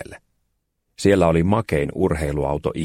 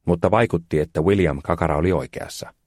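usual speaking voice

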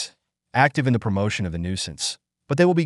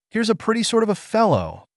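higher-pitched cry of despair; exasperated cry; 12 semitones higher than usual. A narrator's reading. Brass laying down his pen in despair, exasperated cry. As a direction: cry out, at your wits' end. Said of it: "cried Brass, laying down his pen in despair".

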